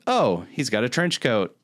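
usual speaking voice

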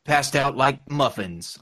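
slow, drawn-out trailer announcer; hammy trailer narrator, stretched words; movie trailer guy. Shown as a demonstration. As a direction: finish a mock film trailer line slowly. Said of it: "trailer guy".

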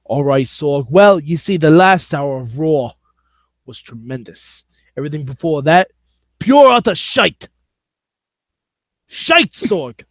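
theatrical mock-British verdict; put-on British accent. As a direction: review it in a hammed-up British accent. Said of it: "in that accent now".

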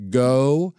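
very stretched, word by word; slow, deliberate emphasis. Real, not acted. Preacher repeating the verse against excuses, very slow and emphatic. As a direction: hold each word of the command.

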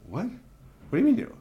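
a father hearing dating rules that baffle him; incredulous what. Retold or imitated. Father baffled by his son's dating advice, incredulous squeak. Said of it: "I'm like".

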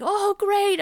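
high eager "oh great"; bright burst of hope; excited. Retold or imitated. Excited quoted thought.

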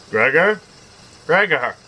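father calling. A narrator's reading. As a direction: call out through the door. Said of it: "he called out".

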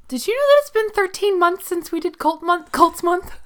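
high, loud indignant question; high excited exclaim. Real, not acted. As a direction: pitch up in mock outrage. Mock outrage at delay.

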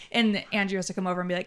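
ordinary talk

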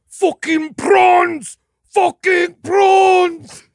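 slow, high drawn-out yell; furious shout. Retold or imitated. Podcaster mimics an enraged man going Super Saiyan, slow shout. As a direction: bellow it in hammed-up rage. Mocking a raging soldier.

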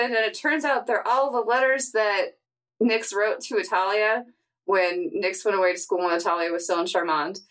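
ordinary talk

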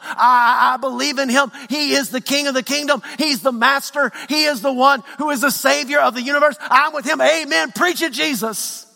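rising preacher's cadence, pushing up in pitch; preaching at full force. Acted. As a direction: preach it out to the whole church. Voicing a disciple, building excitement.

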